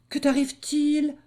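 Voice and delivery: high anxious question; high concerned cub voice; worried little wolf. Acted. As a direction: fret over your hurt brother. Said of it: "s'inquiéta le petit loup".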